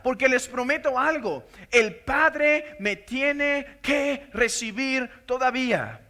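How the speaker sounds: impassioned preaching building to a climax; fervent preaching. Acted. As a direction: build it up with fire from the pulpit. Preaching as Jesus speaking.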